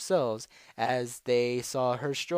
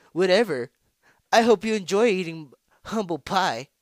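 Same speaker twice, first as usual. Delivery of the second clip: loud, high dismissive taunt; brash, jeering character voice; scornful rival; 7 semitones higher, 9 dB louder than usual. Acted. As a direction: brush her off and rub it in. Rival scoffs at stage magician.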